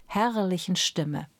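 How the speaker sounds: ordinary delivery, nothing marked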